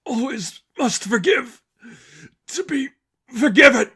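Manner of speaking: slow, very high, loud screamed words; anguished scream. Acted. Angel screaming back at the seraphim, slow and high.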